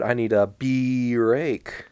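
"bee" stretched and hit hard, very slow; stress on bee. Retold or imitated. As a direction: draw out "bee rake" slowly, punching "bee". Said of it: "really emphasizing the, the sound bee in words".

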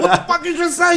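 very high, laughing through it; laughing mock outrage. Host mock-confronting a friend, laughing and high. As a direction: tease them, cracking up.

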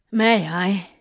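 asking permission, mildly pleading; begging. A narrator's reading. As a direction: beg gently, without raising the pitch. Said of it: "Crowley begged".

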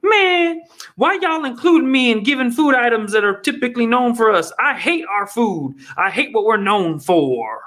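hammed-up indignant whine; mock complainer. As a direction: play an offended diner griping about his own food. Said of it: "I wouldn't be like".

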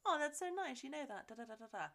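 high, soft sweet chatter; imitated pleased reply. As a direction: lightly act a charmed listener. Pleased polite reply, retold.

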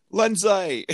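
a hammed-up Australian calling her name; Australian accent. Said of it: "I can't even try to do my Australian accent".

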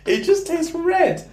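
high, slow wheeze through laughter; helpless laughing. Real, not acted. Friend repeating an absurd flavour answer, laughing helplessly. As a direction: drag the words out, cracking up.

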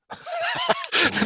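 high, sudden burst of laughter; real laugh. Real, not acted. Laughs at unwelcome answer.

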